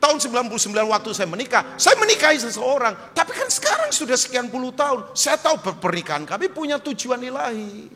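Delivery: high, loud, insistent testimony; full-force preaching. Real, not acted. Pastor testifying about his own marriage, forceful preaching.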